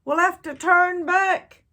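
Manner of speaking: loud, high call. A narrator's reading. Second pirate calling to turn back, loud high call. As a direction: call it across the deck. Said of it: "called another".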